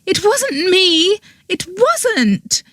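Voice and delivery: voiced high as the accused girl; desperate denial. A narrator's reading. Accused girl denies murder.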